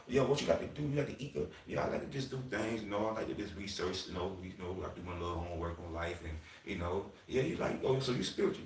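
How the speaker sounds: quiet retold chat. Acting out a quiet date chat.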